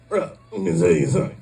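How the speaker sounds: put-on voice, words run together quickly; impersonated dude. Comic imitates guy warning about vaccine, put-on voice. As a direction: do the guy's recognisable quick voice. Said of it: "I'm gonna do this voice a lot".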